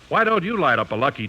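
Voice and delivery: normal talking voice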